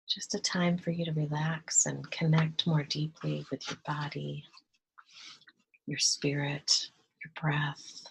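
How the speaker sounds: soft, hushed meditation voice with long pauses; guided meditation. Real, not acted. Guide leading listeners to relax and breathe, hushed meditation voice.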